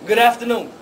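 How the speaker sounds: low, calm and collected; calm low boy's voice. Retold or imitated. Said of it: "he says".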